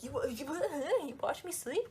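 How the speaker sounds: mock-emphatic question in an actress's halting voice; Kristen Stewart. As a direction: stumble into it, voice lifted, laughing midway. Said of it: "Kristen Stewart voice".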